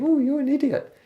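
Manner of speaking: very high falsetto cartoon mockery; silly squeaky voice. Shown as a demonstration. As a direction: pipe it up into a ridiculous squeak. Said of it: "imagining him looking like that and saying".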